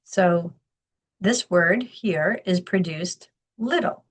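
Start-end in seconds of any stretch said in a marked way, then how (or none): 3.6-4.0 "little" with the first syllable stressed, LIT-tle; teacher showing the strong-weak stress of "little". Shown as a demonstration. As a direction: lean on the start of "little". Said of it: "the emphasis happens in the beginning of the word on the first syllable".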